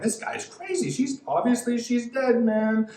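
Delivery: high, scoffing crowd voice; mocking teasing. Mourners mock Jesus, high and scoffing. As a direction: jeer like a doubting mourner. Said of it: "They started teasing".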